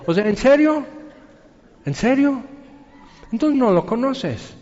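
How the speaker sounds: incredulous. Real, not acted. Preacher reacting in disbelief.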